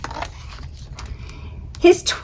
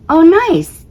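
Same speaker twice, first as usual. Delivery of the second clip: high, loud offended oh nice; sarcastic asteroid; 11 semitones higher, 4 dB louder than usual. Acted. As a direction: throw it back, stung.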